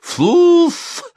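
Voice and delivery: very high, explosive yell; one loud, piercing burst from a sleeper; barked shout. A narrator's reading. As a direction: bark out the nonsense word. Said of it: "рявкнул он".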